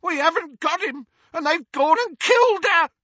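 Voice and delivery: high, loud panicked wail. A narrator's reading. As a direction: wail over his sister. Amycus wailing over his sister.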